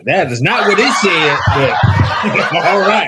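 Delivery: loud laugh-filled protest, dragged slow at the end. Real, not acted. Cohost laughing out loud at the host's misheard ad line. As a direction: laugh through the words, can barely get them out.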